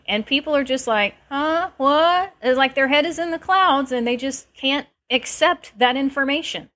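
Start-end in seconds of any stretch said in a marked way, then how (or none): 1.3-2.3 high, puzzled mock reaction; mock confusion, voice up high; baffled huh what. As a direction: play the clueless listener, pitch jumping up. Mimicking clueless people.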